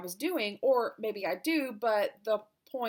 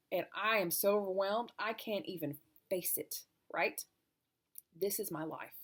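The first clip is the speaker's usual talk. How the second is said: usual speaking voice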